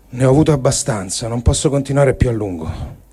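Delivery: ordinary talk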